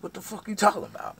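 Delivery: high, loud protest; high-pitched mock indignation; indignant comeback. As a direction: fire back in a retold quarrel. Acted reply in quarrel.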